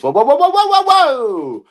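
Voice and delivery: high, loud run swelling then falling; shouted whoas. Acted. Stopping everything after spotting something, shouted whoas. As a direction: holler a long string of whoas.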